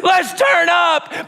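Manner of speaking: high, loud whoop of joy; heavenly cheer. Acted. All of heaven cheering a repentant daughter, loud and high. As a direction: cheer it out like a stadium crowd.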